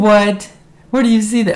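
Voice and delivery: jolted by a cheeky request; surprised. Real, not acted. Startled by a lipstick request.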